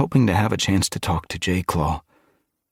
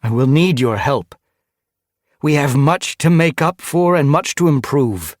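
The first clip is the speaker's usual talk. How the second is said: raised, higher public-speech voice; leader's address; 9 semitones higher, 6 dB louder than usual. Acted. Ice Star asks her clan for help, leader's address. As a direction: address a whole gathering firmly.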